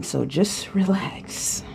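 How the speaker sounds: slow whispered calming; soft whisper. Acted. Spirit calming the nervous host, slow whisper. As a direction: breathe it out slowly, hushed.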